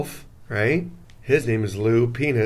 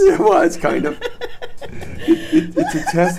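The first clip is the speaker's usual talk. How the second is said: high giggly admission; laughing; 4 dB louder than usual. Real, not acted. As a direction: admit it through a laugh. Caught out, laughing.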